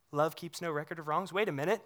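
ordinary talk

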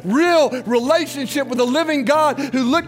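high, loud, driving sermon voice; full-force preaching. Acted. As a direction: push the altar call hard to the congregation.

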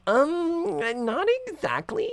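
put-on high, sheepish teen girl voice; girly voice. Kimberly admitting her age, high and sheepish. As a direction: confess it like a coy teenager. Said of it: "she's like".

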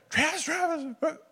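very high squeaky mock alarm; alarmed friends. Friends calling to a crying teammate, mock alarm. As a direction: imitate worried buddies in a high voice.

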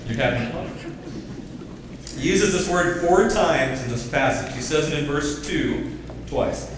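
normal talking voice

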